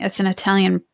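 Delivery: ordinary talk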